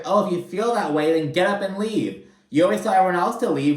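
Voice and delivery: mild mock dressing-down; retold telling-off. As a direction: rattle it off like you're done with her. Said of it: "then Jen tells her".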